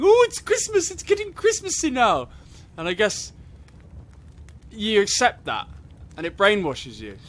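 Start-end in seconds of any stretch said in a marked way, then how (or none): 0.0-2.3 very high, loud gushing mum voice; excited mum. Retold or imitated. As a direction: gush like a giddy mother. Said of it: "running around going".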